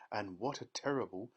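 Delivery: ordinary delivery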